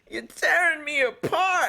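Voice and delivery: very high, drawn-out mock wail; mock anguish. Co-host undone by his friend's impression, mock anguish. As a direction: stretch it out in hammed-up high-pitched despair.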